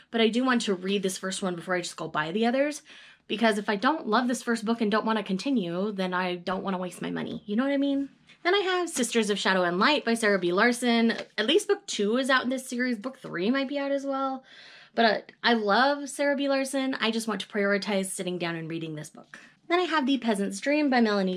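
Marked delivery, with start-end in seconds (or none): none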